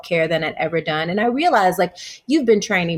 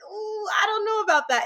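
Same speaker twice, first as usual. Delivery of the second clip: mock wary; 3 dB quieter than usual. Wary Baptist girl hears Buddha.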